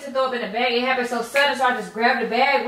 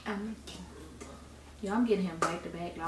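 much softer, low-voiced confiding to viewers; hushed aside to camera; 4 semitones lower, 15 dB quieter than usual. Real, not acted. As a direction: sneak a quiet word to the audience behind his back.

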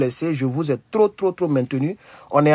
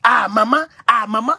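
Cameroonian-style "maman", raised and rising at the end; mimicked Cameroonian accent; 4 semitones higher than usual. Host imitating Cameroonians calling on maman, Cameroonian accent. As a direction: imitate a Cameroonian exclaiming, lifted in pitch. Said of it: "Ça, c'est Cameroun".

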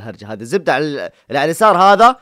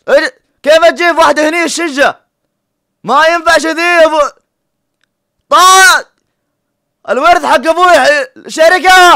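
high, loud yelling; loud exasperated rant; 11 semitones higher, 8 dB louder than usual. Real, not acted. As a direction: rant at the screen. Yelling mock lines at video.

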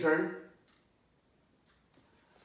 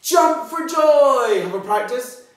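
very high, loud, slow cheer; jumping cheer; 12 semitones higher, 10 dB louder, slower than usual. Shown as a demonstration. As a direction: shout it like you're jumping. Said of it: "I want you to do this".

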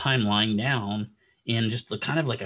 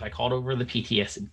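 usual speaking voice

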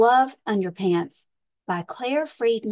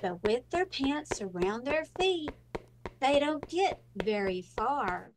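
lively storybook read-aloud with big swings; reading to children; slower than usual. Acted. Librarian reading a pirate picture book to children, lively storytime voice. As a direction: read it like storytime for little kids.

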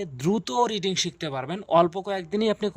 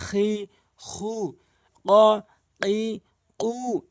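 drawn-out vowels; 2 semitones higher, much slower than usual. Shown as a demonstration. Teacher demonstrating long vowels to viewers, slow and stretched. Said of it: "এক আলিফ করে টেনে পড়বেন".

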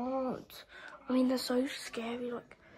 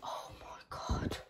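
much softer, scared whisper; frightened whisper; 9 semitones lower, 9 dB quieter than usual. Real, not acted. Player hearing a noise downstairs, frightened whisper. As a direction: barely breathe it out in fear.

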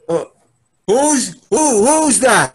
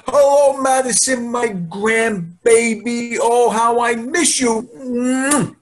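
higher, slow, doting grandpa voice; 6 semitones higher than usual. Acted. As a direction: coo at the grandbaby.

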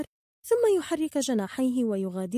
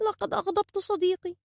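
high, plaintive bird voice; sorrowful, high-pitched confession; sad regret; 9 semitones higher than usual. A narrator's reading. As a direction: lament it remorsefully. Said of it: "قال بحزن".

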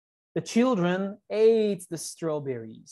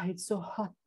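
sighing, breathy whisper; hot and worn out; 3 semitones higher, 9 dB quieter than usual. A narrator's reading. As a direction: sigh it out softly, wilting in the heat. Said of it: "Keeper was hot".

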